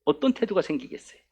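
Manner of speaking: usual speaking voice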